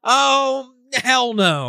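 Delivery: high, loud mock outrage; imitating a dad who just snapped; hammed-up furious refusal; angry dad.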